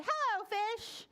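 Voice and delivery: high-pitched cheery hello; boy's greeting. Acted. Boy returning to the fish, high greeting.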